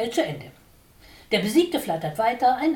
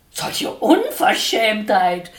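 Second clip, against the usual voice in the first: slow, louder, quivering indignation; trembling outrage; 7 dB louder, much slower than usual. A narrator's reading. Watscheline trembles with outrage at the sparrow. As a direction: let the outrage shake the words. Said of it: "zitterte Watscheline los".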